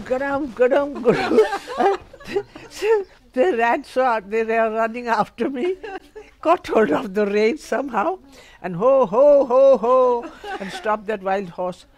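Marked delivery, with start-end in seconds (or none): 0.1-2.0 imitated hoofbeats, rising high and loud; galloping sound. 8.8-10.3 loud high calls to halt a horse; calling to horse. Retold or imitated. Calling a wild horse to stop.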